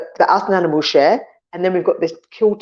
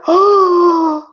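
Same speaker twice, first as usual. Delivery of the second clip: high, loud startled oh; a sudden loud gasp of realization; mock shocked realization; 11 semitones higher, 5 dB louder than usual. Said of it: "And I'm like".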